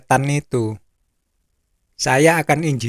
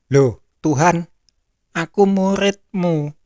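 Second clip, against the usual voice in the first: mildly acted, higher-pitched pleading; taken-aback protest; 4 semitones higher than usual. Rejected disciple protests to the Lord, mildly startled plea. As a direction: protest as if wrongly turned away.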